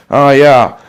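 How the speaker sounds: ordinary talk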